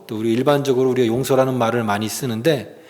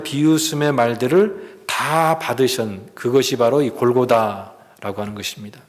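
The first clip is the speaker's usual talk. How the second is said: usual speaking voice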